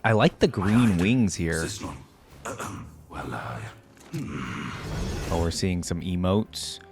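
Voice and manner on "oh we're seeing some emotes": ordinary talk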